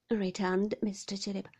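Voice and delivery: plain reading voice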